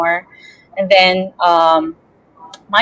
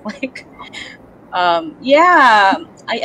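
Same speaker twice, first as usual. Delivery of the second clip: ordinary talk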